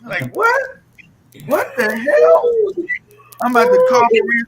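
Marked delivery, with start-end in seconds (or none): 0.3-2.5 slow, drawn-out what the hell; amazed at Maria's flow; stunned disbelief. Real, not acted. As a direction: stretch it in disbelief.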